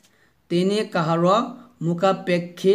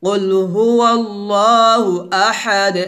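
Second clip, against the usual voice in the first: recitation for repeating; 3 dB louder than usual. Shown as a demonstration. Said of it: "আমার সাথে বলেন".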